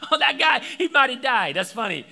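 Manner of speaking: a mock laugh at someone's pain; mock amused viewer. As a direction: play it like you're laughing at a fall. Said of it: "it's like".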